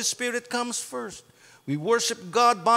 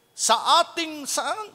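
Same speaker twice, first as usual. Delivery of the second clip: ordinary talk, nothing marked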